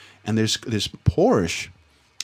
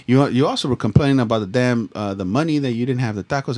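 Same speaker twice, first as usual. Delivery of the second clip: normal talking voice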